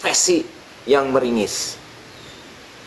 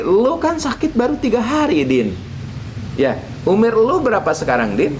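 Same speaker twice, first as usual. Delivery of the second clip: kiai voice; 9 semitones higher than usual. Acted. Kiai questions Udin.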